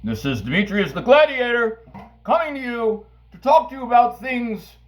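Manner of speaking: grand announcer voice. Acted. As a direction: announce yourself like a gladiator. Grand self-introduction as a gladiator.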